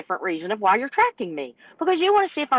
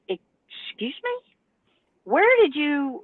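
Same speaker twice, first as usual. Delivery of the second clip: slow, stunned excuse me; can't believe what she's hearing; drawn-out disbelief at the dispatcher; incredulous; 7 semitones higher, much slower than usual. Retold or imitated.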